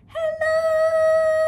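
very high, loud squeaky hello. Shown as a demonstration. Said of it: "use your high voice".

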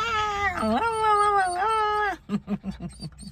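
mock whimper. After mock crying at pet.

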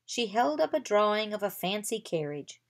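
usual speaking voice

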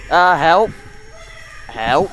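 loud panicky calls for help; panicked cry for help. Real, not acted. Attacked by monster.

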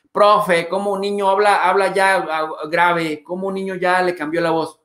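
a hint of a nagging viewer; mock complaining viewer. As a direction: whine it like a fussy critic.

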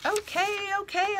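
put-on high-pitched dutiful children's reply; kids calling out. A narrator's reading. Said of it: "a couple of dutiful kids call out".

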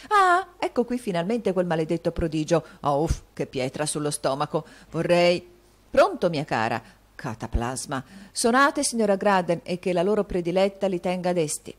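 loud and showy, playing to the room; loud stage asides. A narrator's reading. Actor loudly calling lines backstage at the play. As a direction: actor booming over the stage noise. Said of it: "Pronunziando ad alta voce quelle frasi".

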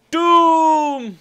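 loud, high, long-held "doom"; dramatic proclamation. Pretend. Player naming his bridge, dramatic proclamation. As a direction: boom it out melodramatically.

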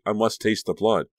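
ordinary delivery, nothing marked

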